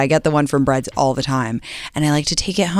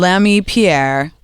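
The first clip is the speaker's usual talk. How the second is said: mock announcement; 2 semitones higher, much slower than usual. Mock announcing new restaurant.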